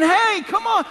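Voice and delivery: high, loud mock protest. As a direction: cry out like a wounded groom. Jesus voiced protesting.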